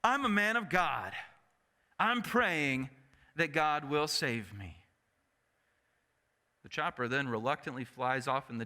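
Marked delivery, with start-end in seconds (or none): 0.0-4.8 angry yell. Retold or imitated. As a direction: yell back, ticked off, at the chopper. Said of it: "the faithful man yells back".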